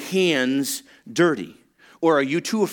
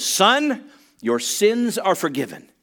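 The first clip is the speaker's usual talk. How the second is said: higher, slower solemn pronouncement; proclaiming forgiveness; 7 semitones higher, slower than usual. Acted. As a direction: announce it with authority.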